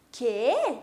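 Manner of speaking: "Que?" blurted loud as an astonished question; puzzled question. Shown as a demonstration. Teacher shows students the interjection "Que?", loudly surprised. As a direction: throw out "Que?" like you can't believe it.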